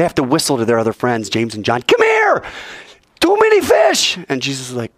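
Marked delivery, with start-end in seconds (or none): 1.8-4.2 high, strained cry for help; urgent calling out. Acted. Calling friends to the overloaded boat, urgent high holler. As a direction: holler across to the other boat. Fishermen call their friends.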